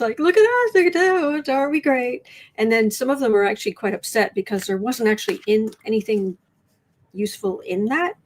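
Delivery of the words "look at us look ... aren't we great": high sing-song mockery of self-praise; smug mocking voice. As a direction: preen like a show-off crowing.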